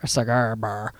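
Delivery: low, soft Newfie accent; Newfoundland accent. Shown as a demonstration. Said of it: "you hear my Newfie accent there?".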